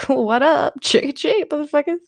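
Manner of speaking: hammed-up grown-up demanding candy; cocky adult trick-or-treater. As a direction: toss it out like a too-cool adult. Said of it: "like he was an adult".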